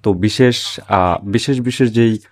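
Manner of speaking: usual speaking voice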